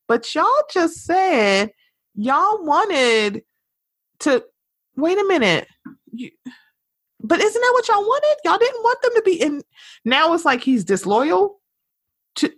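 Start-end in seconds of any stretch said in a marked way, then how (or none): 0.1-9.6 high, climbing disbelief at the crowd; incredulous retort. Retold or imitated. To people dunking on [name], rising disbelief. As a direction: demand it, baffled and rising. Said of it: "I'm like".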